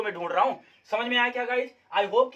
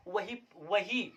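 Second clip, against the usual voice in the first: drawn out; 6 dB quieter, much slower than usual. Shown as a demonstration. Drawn-out example.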